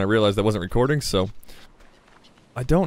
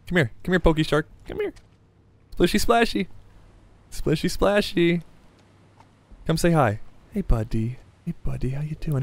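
higher, sing-song calling to a pet; playful coaxing; 4 semitones higher than usual. Pretend. Player calls a pokey shark to come say hi, playfully coaxing. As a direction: lure it over like a shy animal.

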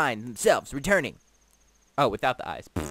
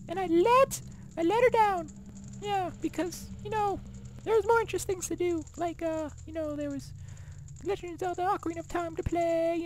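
high, put-on voice of a haughty desert guard. Guard welcoming the hero, in a high put-on voice. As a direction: play the proud thief lieutenant, held high throughout.

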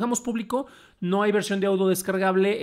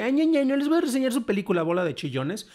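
put-on higher, nasal-free childish "ña ña" taunt; mock a crybaby tantrum, pitched up; teasing sing-song jab at whiners; whiny mocking taunt; 5 semitones higher than usual. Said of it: "como que es una queja de que".

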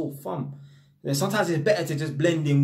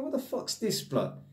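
high, softer imitation of a baffled listener; mock bewildered reaction; 6 semitones higher, 7 dB quieter than usual. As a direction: act out a baffled call recipient, pitched up. Imagined reaction to his cold call.

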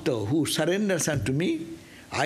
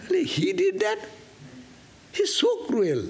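shocked disbelief. Retold or imitated. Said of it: "He said".

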